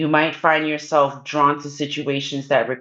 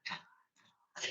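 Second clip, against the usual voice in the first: mock whisper; 6 semitones lower, 14 dB quieter, much faster than usual. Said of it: "they're whispering".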